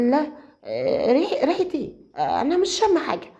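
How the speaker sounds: put-on a nervous girl covering something up, voice jumping up; nervous fiancée dodging the question, high and flustered. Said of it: "ردت مريم بتوتر".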